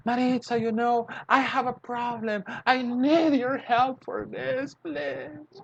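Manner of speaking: high pleading client voice; worried customer. Retold or imitated. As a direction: beg the lawyer for help in a high voice. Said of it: "one of the customers comes".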